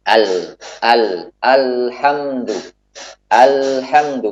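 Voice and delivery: slow alhamdu with the l of "al" flowing just briefly; light lam sukun. Shown as a demonstration. Teacher showing students a lam sukun, slow and careful. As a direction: draw out "alhamdu" slowly, letting the lam flow a little. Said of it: "ketika kita mengucapkan lam dalam keadaan sukun".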